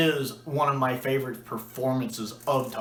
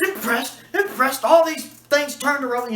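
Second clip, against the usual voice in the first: incredulous outburst; 11 semitones higher, 5 dB louder than usual. Retold or imitated. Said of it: "He goes".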